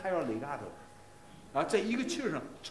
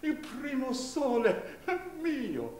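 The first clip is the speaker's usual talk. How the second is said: hint of a sob; 7 semitones higher, slower than usual. Shown as a demonstration. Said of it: "所以不说哭腔吧".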